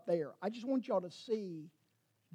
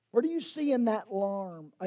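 ordinary talk, nothing marked

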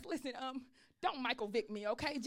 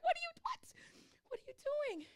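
very high incredulous what; baffled disbelief; 12 semitones higher, 4 dB quieter, much faster than usual. Retold or imitated. Pastor baffled that her hurt dog is happy, high incredulous. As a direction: sound completely thrown.

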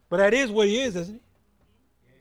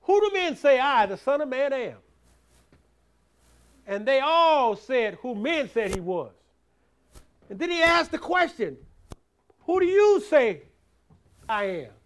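raised, louder preacher's drive; preaching at full force; 4 semitones higher, 6 dB louder than usual. Acted. Preacher drives the point home to the church, loud. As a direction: push it out to the congregation.

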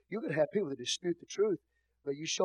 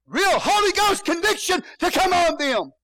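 full-force preacher's shout, much higher and louder; preaching shout; 14 semitones higher, 11 dB louder than usual. Acted. Pastor calling down Holy Ghost conviction on disputers, full shout. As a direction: thunder it out like a revival preacher.

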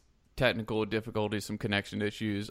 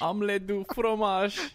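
mock French; 9 semitones higher than usual. Repeating cartoon French line.

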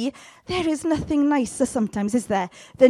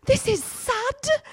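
much higher, slow and wailing; mock sister; 12 semitones higher, 6 dB louder, much slower than usual. Sister refusing to turn off sad songs, high mock whine. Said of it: "she'd be like".

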